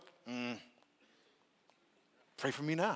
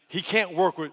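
ordinary talk

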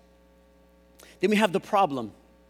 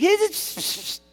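high, louder "hey" into hissed shushing; hushing a crowd; 3 dB louder than usual. Acted. Preacher as Caleb quieting the people, shushing. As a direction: shush a crowd sharply, acted out. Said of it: "Then Caleb quieted the people".

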